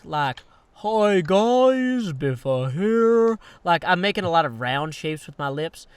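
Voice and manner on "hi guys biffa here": higher, slow, rounder mouthed greeting; Biffa impression. Demoing his Biffa impression to a friend, round lips. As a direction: make round shapes with the lips on every word. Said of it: "the Biffa impersonation is kind of rounder mouthed".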